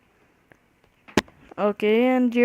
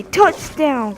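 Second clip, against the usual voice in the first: higher, louder victory call; proud kid's touchdown cry; triumphant yell; 6 semitones higher, 7 dB louder than usual. Retold or imitated. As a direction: shout it like you just scored. Kid throws ball, yells touchdown.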